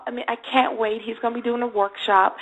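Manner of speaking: usual speaking voice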